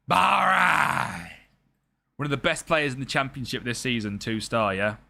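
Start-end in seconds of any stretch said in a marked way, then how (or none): 0.1-1.3 loud, high exclamation; sudden shout. Real, not acted. Streamer reacts to scouted two star player, shouting. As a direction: belt it out as one raised cry.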